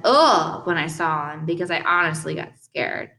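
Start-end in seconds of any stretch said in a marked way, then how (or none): 0.0-0.7 higher, louder cry of shock; startled "ugh". A narrator's reading. Boy scared by a face he saw, startled cry. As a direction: let out a short scared "ugh". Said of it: "but I kinda went".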